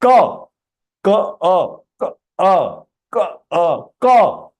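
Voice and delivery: Ka split into a clipped K and a full short "uh"; syllable breakdown. Shown as a demonstration. Teacher shows students that half Ka plus A makes Ka, sounded out. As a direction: sound out Ka, A piece by piece, then blended. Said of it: "pronouncing Ka half only and I am pronouncing A completely".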